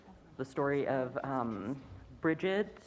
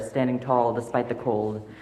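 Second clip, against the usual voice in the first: usual speaking voice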